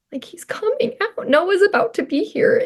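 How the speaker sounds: high-pitched, hushed thrill; retold excitement. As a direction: let the realisation lift your voice high. Retelling realising baby is coming.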